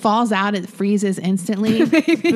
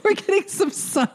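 high-pitched mock delight; imagined sunbather; 11 semitones higher than usual. Co-host imagines the man enjoying rare sun, high mock delight. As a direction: play a sun-starved Englishman, pitched high. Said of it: "I like how he's like".

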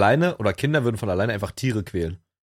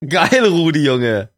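excited shout; 4 semitones higher, 8 dB louder, much slower than usual. Real, not acted. Cheering the magpie's escape.

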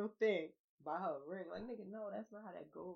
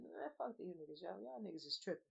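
quieter, fed-up grumble under the breath; muttered complaint; 3 semitones lower, 10 dB quieter than usual. Retold or imitated. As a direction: mutter it like a tired parent. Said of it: "I'm not the only person that just".

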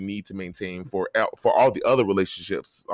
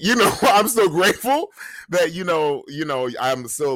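laughing; 9 semitones higher, 5 dB louder than usual. Real, not acted. Laughing after saying fuck you.

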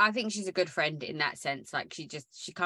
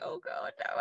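panicked whisper; 10 dB quieter than usual. Retold or imitated. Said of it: "It's like".

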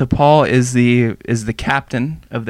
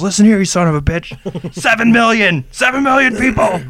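escalating hammed-up yell; mock angry outburst; 9 semitones higher than usual. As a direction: explode at the enemy like a furious captain. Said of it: "Dad fucking mans up and says".

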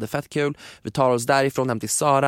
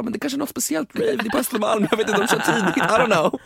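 much higher, airy clueless voice; naive self; 11 semitones higher than usual. Retold or imitated. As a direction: play your gullible past self. Imiterar sitt naiva jag. (högt, naivt och ovetande)

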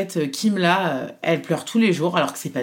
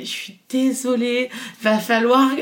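higher, slow, apologetic little voice; embarrassed doctor; 7 semitones higher, slower than usual. Retold or imitated. Imitant le médecin gêné qui s'excuse, plus aigu et lent. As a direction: mimic the shy doctor apologizing, higher and slower. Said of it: "il est hyper gêné".

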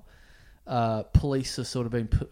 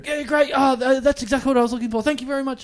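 much higher, fast, laughing gratitude; gushing thanks; 12 semitones higher, 5 dB louder than usual. Retold or imitated. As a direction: thank them and run. Overjoyed thanks, bolting away.

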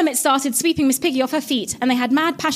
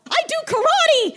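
very high, proud diva voice; haughty Miss Piggy. Acted. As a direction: announce it with diva pride. Piggy defends herself.

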